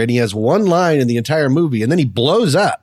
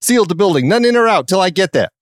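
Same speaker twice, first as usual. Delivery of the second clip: put-on New York cop accent, raised pitch; Gary Oldman; 5 semitones higher than usual. Police captain ordering a lockdown, mock New York accent. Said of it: "kind of a New York accent".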